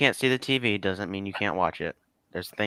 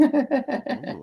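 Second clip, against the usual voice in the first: mock impressed.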